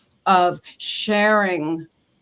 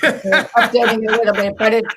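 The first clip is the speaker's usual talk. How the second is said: laughing; 3 semitones higher, 7 dB louder than usual. Real, not acted. Laughing while admitting website unfinished.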